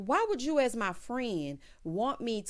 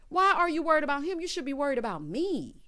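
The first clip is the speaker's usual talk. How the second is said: quicker, high mock scolding; imitated indignant scolding; 6 semitones higher, 4 dB louder than usual. [name] telling Howard to worry about her, brisk indignation. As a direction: rattle it off high, landing on ME. Repeats the complaint faster.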